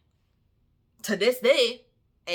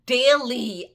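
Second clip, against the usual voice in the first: Daily punched much louder on a falling tune; loud emphatic daily; 4 semitones higher, 10 dB louder than usual. Real, not acted. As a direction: slam the word Daily down in exasperation. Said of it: "slapping me in my face daily".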